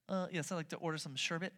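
soft, hesitant order; awkward ordering. Acted. Customer ordering dessert with the new word, awkwardly. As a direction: quietly try out the odd-sounding word. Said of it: "You just sound weird".

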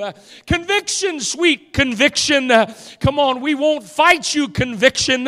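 high, loud revival preacher in full flow; impassioned preaching. Real, not acted. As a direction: preach it with mounting urgency to the congregation.

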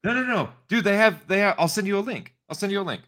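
high, eager reassurance; eager protest. Real, not acted. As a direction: cut in, insisting. Urgently cuts off the complaint.

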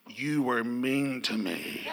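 a hint of a wounded daughter, slow and low; imitating daughter's hurt tone after a bad dream; hurt little voice. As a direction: mimic her hurt accusation, slowly. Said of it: "Her voice changed".

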